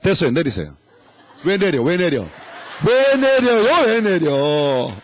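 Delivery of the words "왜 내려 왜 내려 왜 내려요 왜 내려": mock protest.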